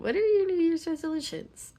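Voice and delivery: re-ask it with a lift; excited question. Real, not acted. Repeats the question brighter after laughing.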